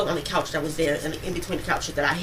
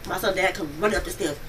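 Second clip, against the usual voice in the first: ordinary talk, nothing marked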